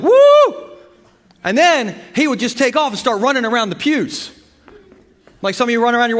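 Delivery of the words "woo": high whooping yell of joy; mimicking Brother Phil's joyful holler. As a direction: let out a big church whoop. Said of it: "He'd be like".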